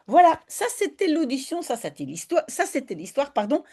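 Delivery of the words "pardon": normal talking voice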